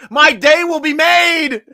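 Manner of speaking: excited. Real, not acted. Hopeful pick, excited.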